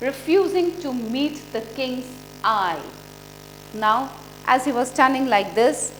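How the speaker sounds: ordinary delivery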